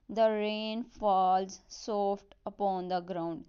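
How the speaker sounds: very slow, each beat marked; rhythmic reading. Shown as a demonstration. Teacher to students reading a line in its meter, slow rhythmic beats. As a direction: read it out beat by beat, da-DUM. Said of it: "जब आप इस line को read करते हैं".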